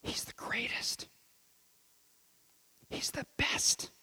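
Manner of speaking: hushed reverent whisper. Acted. Preacher whispering Jesus's praise of John to the church, hushed. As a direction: breathe it like a secret. Intimate aside about John.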